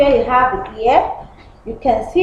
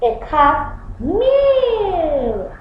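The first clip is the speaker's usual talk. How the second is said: ordinary talk, nothing marked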